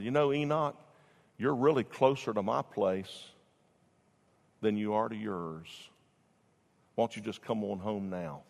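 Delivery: the Lord softly calling a friend home; gentle invitation. Acted. The Lord calls Enoch home.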